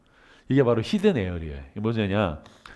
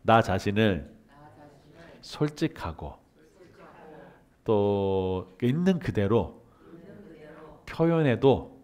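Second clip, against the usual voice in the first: reassuring affirmation; 3 semitones lower, 4 dB quieter, slower than usual. Shown as a demonstration. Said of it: "여러분 따라해서 볼까요?".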